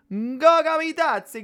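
high, slow Italian accent. Shown as a demonstration. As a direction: roll the name out like an Italian. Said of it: "tiene que decirlo con el acento italiano".

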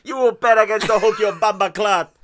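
loud hammed-up Jamaican patois; mock Jamaican accent. Hook as [name] cursing a pirate, in mock Jamaican. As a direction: hurl it in booming mock Jamaican. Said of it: "Why is he [name]?".

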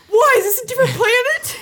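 high, loud mock wail; hammed-up fan meltdown, almost crying; outraged Star Wars fans. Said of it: "Star Wars fans are like".

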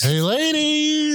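very high, slow hey ladies; squeaky dork greeting. Pretend.

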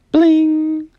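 chime imitation. Shown as a demonstration. Narrator showing kids the page-turn chime, high ringing bling. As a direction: ring it out like a page-turn bell. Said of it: "hear the chimes ring like this".

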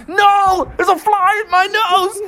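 very high, strained panic; hammed-up freak-out in a squealing pitch; wigging out. Bubby has a fly in his nose, panicking.

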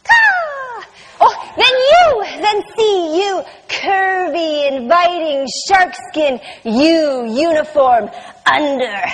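low, slow, lingering word-tasting; sensual savoring. Acted. Performer savoring the letters of the word, low and lingering. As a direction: roll each word around like something delicious.

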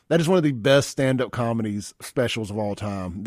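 ordinary talk, nothing marked

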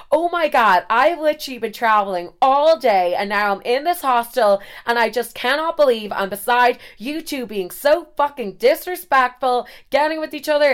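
put-on loud, high American accent rant; outraged American tourist voice; American guy. American traveller scolding a kissing couple, hammed-up outraged American accent. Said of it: "the American guy is like".

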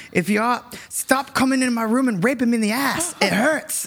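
high-pitched attempt at an Australian accent, laugh creeping in at the end; poor Australian accent. Retelling a funny gif to a friend, poor high Australian accent. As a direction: badly imitate an Australian, pitched well up. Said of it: "I can't do the accent very well".

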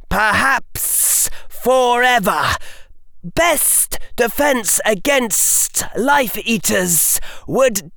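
high, slow menacing villain voice; cold rasping evil emperor. Acted. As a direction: threaten like an ancient tyrant. Dark Lord threatens Harry.

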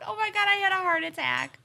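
loud startled outburst. Real, not acted. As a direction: jump at a sudden noise. Phone suddenly goes off.

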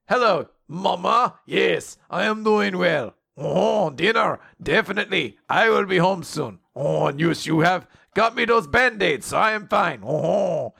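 put-on dutiful son in hammy French accent. Charles on the phone to his mama, hammed-up French accent. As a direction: lilt it like a cartoon Frenchman. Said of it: "is apparently very French".

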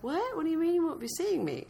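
high, small-voiced disbelief; mock bewilderment. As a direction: squeak the question in confusion. Retold shocked reply to caller.

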